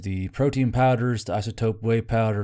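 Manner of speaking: plain reading voice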